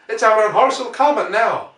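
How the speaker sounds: loud, high, eager report; excited telling. Acted. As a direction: rush the news out. Ogilvy tells Henderson excitedly.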